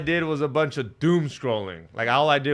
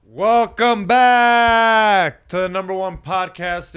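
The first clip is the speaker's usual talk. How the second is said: loud, slow hype podcast intro; announcer voice; 4 semitones higher, 8 dB louder, slower than usual. Acted. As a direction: announce it like a host.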